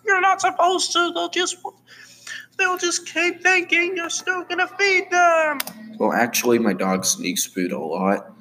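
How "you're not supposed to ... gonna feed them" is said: extremely high squeaky cartoon voice; squeaky arguing character. Character arguing about humans, squeaky and insistent. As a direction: argue in a tiny, piercingly high voice.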